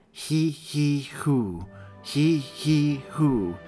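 puffed breathing rhythm; breathing demo. Shown as a demonstration. Joey coaching Mary's breathing, demonstrating. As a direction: model the birth breathing. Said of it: "remember your breathing".

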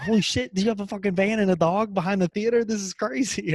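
high, loud mock amazement from the crowd; hammed-up astonished bystanders; amazed crowd. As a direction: play the stunned onlookers, voice jumping up. Crowd amazed by van and dog.

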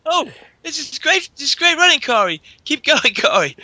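high, gentle cheering for a runner; a mild, understated Canadian cheer; cheery Canadian cheerleader. Retold or imitated. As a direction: egg Corey on, sweetly and politely. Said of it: "the Canadian guy's like".

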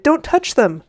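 high, loud warning; urgent shout. A narrator's reading. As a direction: cry out to stop her hand. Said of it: "he shouted".

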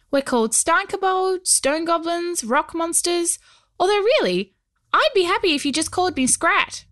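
high little goblin voice; tiny homunculus voice. Acted. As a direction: speak as a tiny stone creature. Goblin Scrat speaks.

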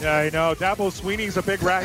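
usual speaking voice

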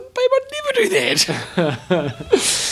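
squeaky high protest, cracking into laughter; mock innocent denial. To his girlfriend, mock-innocent and laughing. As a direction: act falsely innocent, laughing through it. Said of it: "I said".

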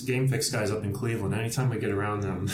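normal talking voice

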